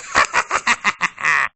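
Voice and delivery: high wicked witch cackle; evil cackle. Acted. Disguised queen cackles over her plan. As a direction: cackle with glee.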